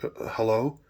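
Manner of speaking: put-on hesitant, questioning boy's hello; flustered hesitation. A narrator's reading. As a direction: manage only an awkward greeting. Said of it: "was all I could manage".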